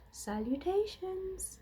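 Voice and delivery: high, slow drawn-out greeting; a hint of a thin voice. A narrator's reading. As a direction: greet grandly, stretching it out. The thin spider voice speaks.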